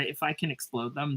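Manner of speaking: normal talking voice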